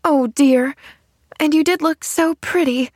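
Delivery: high, plaintive lament; understated, close-to-tears disappointment; almost sobbing. A narrator's reading. Girl leaves her aunt's room in dismay, almost sobbing. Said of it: "almost sobbed Pollyanna".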